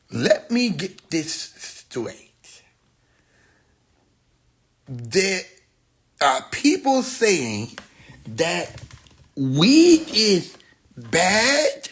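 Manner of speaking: very slow, pausing on each word; slow mocking read. Mocking the woman's video, slow and drawn out. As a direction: drag it out in disbelief.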